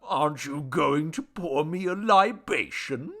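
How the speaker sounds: whiny, wheedling deity; whining. A narrator's reading. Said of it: "whined the deity".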